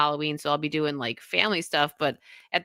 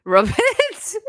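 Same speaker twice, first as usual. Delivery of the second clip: squeaky creepy frog; 5 dB louder than usual. Acted.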